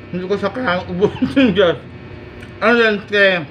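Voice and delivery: talking while chewing pizza; words muffled by full mouth; mouth full of food. Real, not acted. Seen 0: cheeks puffed, chewing, food visible in open mouth at 0.6.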